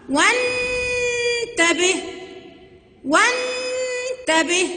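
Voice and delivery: "وانتبه" with a long, high held n; very slow wannn-tabeh for "وانتبه"; alef left silent. Shown as a demonstration. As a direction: sustain the n in "وانتبه", skipping the alef. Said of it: "ما نطقتش الألف يبقى انتي واصلة".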